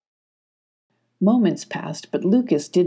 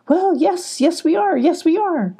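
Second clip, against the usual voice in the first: much higher, bright sing-song; cheerful Clarissa; 11 semitones higher, 3 dB louder than usual. A narrator's reading. Clarissa greeting the startled foxes, much higher. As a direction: surprise them kindly.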